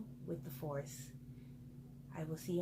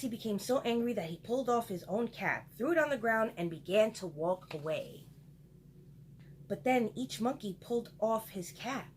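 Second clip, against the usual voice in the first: plain reading voice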